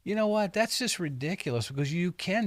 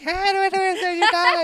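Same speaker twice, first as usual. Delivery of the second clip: tiny high squeaky voice; a giant with a mouse voice; 8 dB louder than usual. Pretend. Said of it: "if he had a little squeaky voice".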